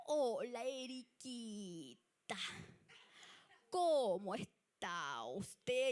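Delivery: mock greeting drawn out very slowly; very slow talker. Imitating sister Lailita's very slow greeting. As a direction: stretch every word like the unhurried sister.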